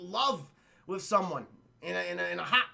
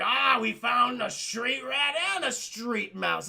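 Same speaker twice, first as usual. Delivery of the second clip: slow, higher put-on guard voice; gloating guards; 4 semitones higher, 4 dB louder, slower than usual. Guards come upon the couple, mock gloating. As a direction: draw it out as a mock-menacing discovery. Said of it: "they're like".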